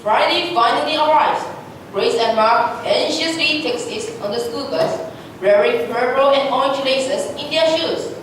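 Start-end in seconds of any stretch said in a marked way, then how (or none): none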